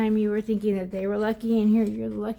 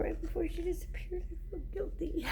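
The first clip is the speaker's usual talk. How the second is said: whisper; 9 semitones higher, 10 dB quieter than usual. Real, not acted. Confessing guilt about her dog.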